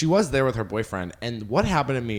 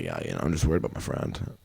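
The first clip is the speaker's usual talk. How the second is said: much lower, butch masked-up voice; masculine Mask voice; 12 semitones lower, 4 dB quieter than usual. Retold or imitated. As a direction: drop into a macho low register. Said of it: "Did your voice change when you did it?".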